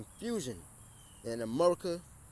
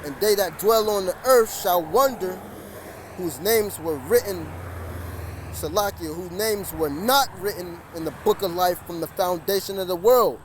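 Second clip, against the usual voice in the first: loud, rising scripture preaching; fiery street sermon over traffic; 4 dB louder than usual. Real, not acted. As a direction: proclaim it to the street. Reads scripture aloud on street.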